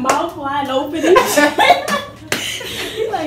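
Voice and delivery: loud laughing while talking. Real, not acted. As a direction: talk through a big laugh. Describing footage, laughing.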